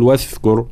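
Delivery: واذكر said as "wathkur"; wrong voiceless th. Shown as a demonstration. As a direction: show the mistake: devoice the ذ in واذكر into a ث. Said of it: "لكي لا تصير شبيهة بحرف الثاء".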